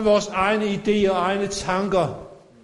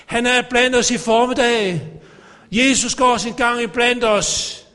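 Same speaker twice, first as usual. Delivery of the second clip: raised, ringing sermon voice; fervent preaching; 3 semitones higher than usual. Acted. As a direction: preach it out to the congregation. Preaching to congregation. (inderlig prædiken)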